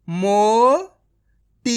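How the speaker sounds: मोटी stretched very slowly, ओ held long; drawn-out reading. Shown as a demonstration. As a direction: sound out मोटी slowly for a child. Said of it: "direct मात्रा का उच्चारण करके पढ़ेंगे".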